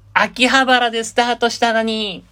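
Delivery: high, sustained announcer shout; ranking title call. Pretend. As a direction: call out the ranking entry on one high note. Calls out the third-place title.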